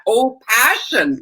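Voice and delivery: slow, drawn-out "passion"; stress on passion. Shown as a demonstration. Host telling what she hears from people, stressed "passion". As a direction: stretch and lean into the word "passion". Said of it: "I hear that".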